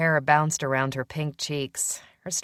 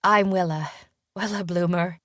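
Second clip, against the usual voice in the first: put-on lightly British young woman, slightly higher; faint British accent; 4 semitones higher than usual. A narrator's reading. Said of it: "she said in a faint British accent".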